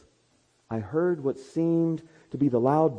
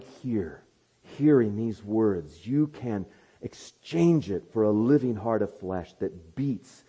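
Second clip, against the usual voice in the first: pastoral prayer; 2 semitones lower, slower than usual. Acted. Closing prayer.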